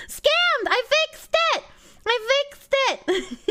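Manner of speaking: high gleeful shout; triumphant squeal. Pretend. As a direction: gloat with a laugh. Mock triumph, laughing.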